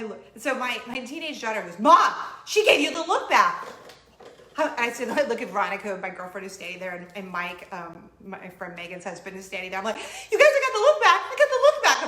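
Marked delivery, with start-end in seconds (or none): 1.8-3.7 loud, high excited exclamation; excited teenager. Retold or imitated. Teenage daughter exclaims to mom. 10.0-12.1 high, loud laughing squeal; giddy, over-the-top celebration; lottery-win excitement. Retold or imitated. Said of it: "like I just won the lottery".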